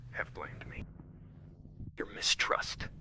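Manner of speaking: hushed, bitter whisper; whispered reproach. Acted. Alf whispers blame at Maris.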